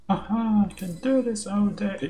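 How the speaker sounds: high, slow, drawn-out mock heroic line; imitating game character. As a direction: parrot the pawn's boast, stretched out slowly. Echoes the pawn's game line.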